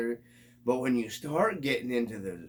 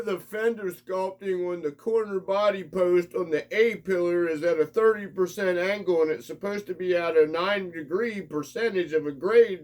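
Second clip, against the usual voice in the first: nitpicky scale nerd; 6 semitones higher, 4 dB louder than usual. Retold or imitated. Mocking scale fans nitpicking body angles, pedantic. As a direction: lecture it like a fussy expert.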